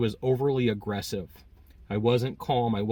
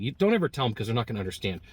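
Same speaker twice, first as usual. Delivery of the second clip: ordinary talk, nothing marked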